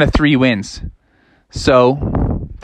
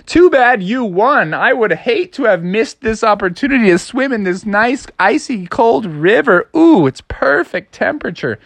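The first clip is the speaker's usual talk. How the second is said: fake cheerful; 6 semitones higher than usual. Wolves pretend icy river is nice.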